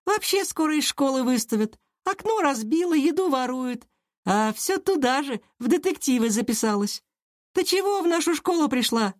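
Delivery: very high, scornful schoolgirl; disgusted. A narrator's reading. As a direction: pile up accusations in a high voice. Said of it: "Лера брезгливо посмотрела на Киру". (брезгливо, высоким девчачьим голосом)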